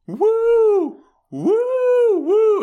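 loud, high drawn-out woos; crowd wooing. Retold or imitated. People at the show cheering, high loud woos. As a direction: imitate a cheering concert crowd. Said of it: "people are going".

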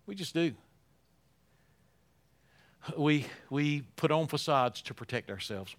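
ordinary talk

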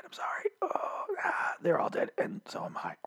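dying whispered squeak; fading whispered falsetto. Retold or imitated. Vlogger imitating a dying man's final whisper. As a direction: breathe out the last words in a whisper. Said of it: "He wasn't that high pitched".

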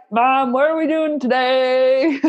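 slow, lightly voiced innocent kid; little boy voice. Imitating her son asking about the day, slow and sweet. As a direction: play the clueless kid asking his mom.

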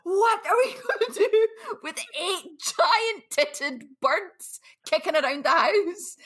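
high, slow, laughing husband quote; mock exasperated husband. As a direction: ham up his disbelief, laughing through it. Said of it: "he was like".

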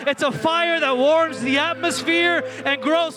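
loud, high, stirring call to praise; fired-up preaching. Real, not acted. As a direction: whip the crowd up to praise. Hype preaching opening the service.

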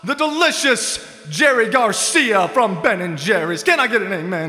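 preacher. Acted. Mock preacher praising ice cream.

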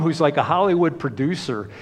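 normal talking voice

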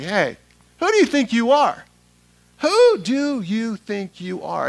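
loud, high trash-talk challenge; talking smack. Acted. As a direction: throw it down like Atlanta street banter. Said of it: "talking smack".

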